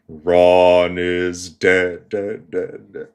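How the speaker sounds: very slow, drawn-out creepy voice. Retold or imitated. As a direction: stretch each word out eerily, like slowed-down tape. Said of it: "in this creepy fucking voice".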